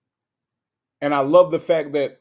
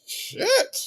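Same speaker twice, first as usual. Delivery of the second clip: high whispered shit. Real, not acted. Reacting to viewer turning heads.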